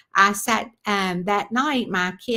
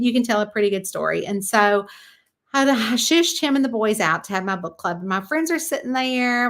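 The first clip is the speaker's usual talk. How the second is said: usual speaking voice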